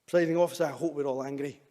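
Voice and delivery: ordinary talk, nothing marked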